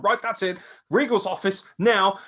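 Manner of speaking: high, bossy mock command; mock Gargano heel. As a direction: order them off like a petty heel. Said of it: "I was like".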